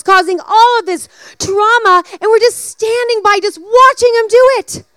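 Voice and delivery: impassioned, rising preacher's call; high, loud sermon climax; preaching. Acted. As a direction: build to a loud plea to speak the truth. Sermon application at full force.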